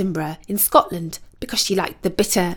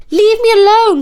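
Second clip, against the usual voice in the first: very high, loud protest; crying out; 13 semitones higher, 8 dB louder than usual. A narrator's reading. Ashamed princess to the nagging carp, crying out. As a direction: wail it to push him off. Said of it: "cried Beatrice".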